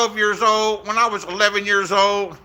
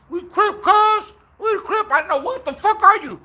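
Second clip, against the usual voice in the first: mock gang kid; 13 semitones higher than usual. Kids surrounding him on his bike, high mock taunt. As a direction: voice the young Crips way up high. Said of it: "I'm like / they surround me".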